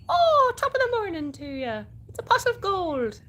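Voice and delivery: high, loud mock-Irish lilt; put-on Irish accent. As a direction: play it as a high, cartoonish Irish greeting. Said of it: "I need to do an Irish accent for that".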